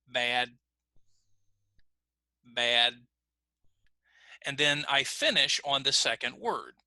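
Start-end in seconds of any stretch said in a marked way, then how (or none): 0.1-2.9 BAD cut off on an unreleased D; stop on the D. Shown as a demonstration. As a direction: say "Bad" and hold the final d without releasing it. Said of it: "I stop on the D".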